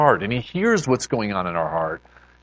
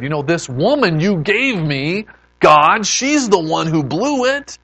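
higher-pitched indignant complaint; aggrieved blaming; 5 semitones higher, 3 dB louder than usual. Acted. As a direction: shift the blame onto her, protesting to God. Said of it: "that kinda sounds familiar to another guy".

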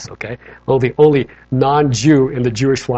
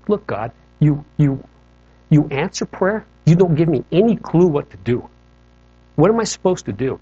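higher, frustrated pleading; angry plea to God; 3 semitones higher, 4 dB louder than usual. Retold or imitated. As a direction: demand an answer from God, voice raised in pitch. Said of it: "And I'm angry".

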